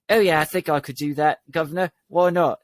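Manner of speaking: kid's hammed-up attempt at an English accent; mock English "guv'nor" accent; bad English accent. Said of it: "Can you do an English accent?".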